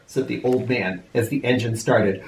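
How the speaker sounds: usual speaking voice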